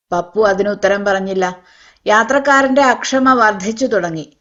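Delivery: usual speaking voice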